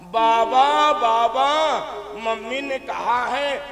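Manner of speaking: put-on slow, loud little boy calling grandpa; a small child calling out to grandpa. Little son calls his grandfather.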